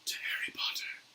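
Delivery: reverent whispered toast; hushed whisper. Acted. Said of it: "saying in hushed voices".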